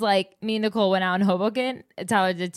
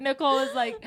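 ordinary talk

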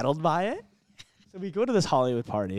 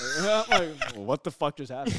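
laughing disbelief; 3 semitones higher than usual. Real, not acted. Laughing while retelling the snub.

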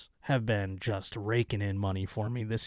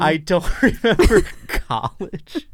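repeating it through a laugh, a bit slower; laughing echo; 6 semitones higher, 6 dB louder, slower than usual. Retold or imitated. To a friend, repeating her confession while laughing. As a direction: laugh the line back at her.

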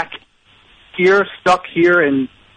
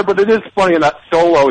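usual speaking voice

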